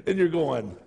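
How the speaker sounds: ordinary talk, nothing marked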